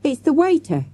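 put-on high child's exclamation; shouting. A narrator's reading. Child recognising the captive, shouting. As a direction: call out the discovery, pitched high. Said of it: "shouted Averil".

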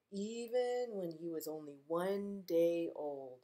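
gentle read-aloud storytime voice; reading to children. Acted. Librarian reading a picture book to kids, gently.

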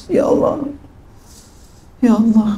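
mock weeping cry of Ya Allah; imitated crying prayer. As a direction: act out someone sobbing in prayer. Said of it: "Sampai nangis-nangis".

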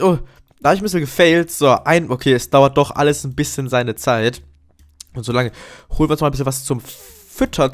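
ordinary talk

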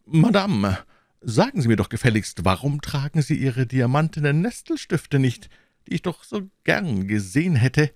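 high, stirred voice of an upset king; agitated king. Acted. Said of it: "sagte zu ihr mit bewegter Stimme".

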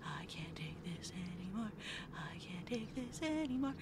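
unvoiced, breathy whisper of dread. Real, not acted. Gamer whispering in fear at a creepy level. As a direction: hush it like you're afraid to be heard.

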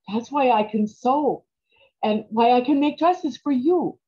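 normal talking voice

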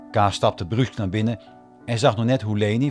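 normal talking voice

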